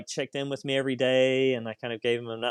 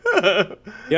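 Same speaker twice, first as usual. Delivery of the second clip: laughing; 6 semitones higher, 6 dB louder, much slower than usual. Real, not acted. Ticking off symptoms, laughing.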